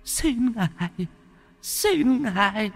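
whimpering plea. Acted. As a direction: beg for mercy in a tiny sobbing voice. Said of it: "mếu máo". (van xin mếu máo)

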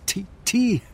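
high, loud cry of a name; anguished call. Acted. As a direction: call out to a lost friend. Calls out dead friend's name. (verzweifelt den Namen rufen)

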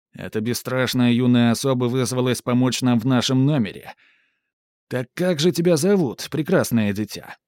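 loud, sugary showman; ringmaster announcement. Acted. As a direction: present her to the crowd. Сарацин представляет девочку. (слащавый конферансье)

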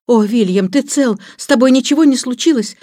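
high, loud worried wife; alarmed cry. A narrator's reading. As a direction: cry out in alarm for your husband. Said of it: "кричала она". (встревоженные восклицания жены)

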